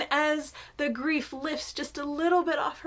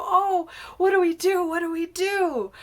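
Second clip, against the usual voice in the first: higher, louder mock dismay; fretting; 6 semitones higher, 6 dB louder than usual. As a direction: fret aloud, playing up the helplessness. Worried people fret outside cave.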